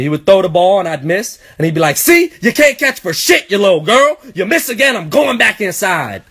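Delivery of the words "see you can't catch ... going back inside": high-pitched mocking taunt; trash-talking dad. Dad goading his son after a missed ball, taunting. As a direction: imitate a father needling his kid. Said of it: "He started talking shit to me".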